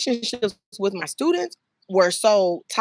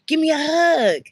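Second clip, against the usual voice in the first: cheerful hug invite; 7 semitones higher, 4 dB louder than usual. Acted. Greeting a child warmly.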